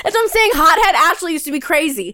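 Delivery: loud and laughing, high-pitched; telling it at full volume, cracking up; excited. Real, not acted. Retelling her screaming fit, laughing.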